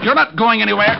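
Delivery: loud, high command; shouted order. Acted. Detective blocking the suspect, shouting loud and high. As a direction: bark it to stop him. Detective stopping a fleeing man.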